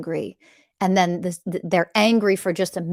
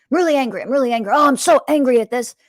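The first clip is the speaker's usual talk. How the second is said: higher and louder mock anger, acting out an angry partner; 5 semitones higher, 5 dB louder, faster than usual. Shown as a demonstration. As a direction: play the angry partner, hammed up. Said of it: "for example".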